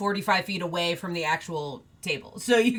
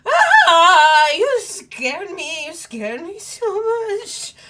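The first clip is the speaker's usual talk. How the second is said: relieved cry; 10 semitones higher, 8 dB louder, slower than usual. Acted. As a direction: wail with relief after a scare. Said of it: "March here goes".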